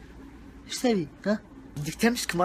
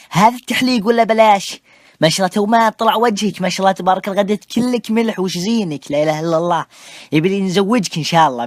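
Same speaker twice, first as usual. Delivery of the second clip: put-on loud, gushing blessing of the young man; loud doting praise; 8 dB louder than usual. Doting elder gushes over the young man's shaved face, loud praise. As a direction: boom out the compliments like a proud elder.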